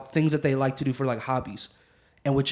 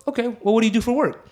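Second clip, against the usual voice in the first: raised questioning voice; 7 semitones higher than usual. Retold or imitated. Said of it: "you go".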